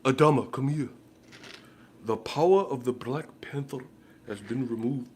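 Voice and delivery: a hint of a solemn royal decree; lightly put-on movie king. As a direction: pronounce it like a film ruler. Voicing movie character.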